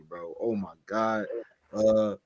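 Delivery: ordinary talk, nothing marked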